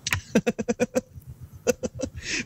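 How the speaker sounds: high breathy giggle; giggling. Real, not acted. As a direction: giggle quietly. Laughing at quote.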